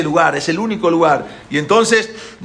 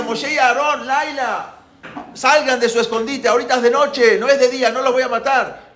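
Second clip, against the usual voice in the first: high, raised calling voice; 9 semitones higher, 4 dB louder than usual. Acted. Pharaoh calls Moshe and Aarón out of hiding, calling out. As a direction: call out as if to someone hiding.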